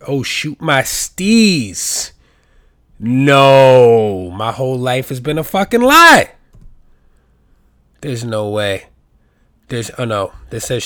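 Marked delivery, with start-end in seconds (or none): 0.1-2.1 very slow, stretched out, savoring each word; slow drawn-out catchphrase. Shown as a demonstration. Pitching the phrase to the audience, very slow. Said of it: "people should start saying it". 3.0-6.3 loud, drawn-out disbelief; dismayed. Real, not acted. Finding the lyric is wrong, loud and dismayed. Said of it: "reading lyrics, shocked".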